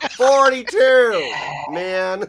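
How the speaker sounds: slow, loud, high disbelief; stunned disbelief. Real, not acted. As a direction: drag it out in shock. Shocked at enemy's AC roll.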